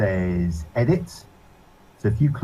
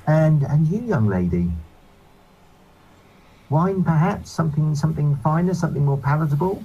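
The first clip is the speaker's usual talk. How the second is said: lightly voiced prissy nobleman, a touch higher; fussy gentleman voice; 6 semitones higher than usual. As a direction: sniff at the tavern, politely. Same NPC offers wine.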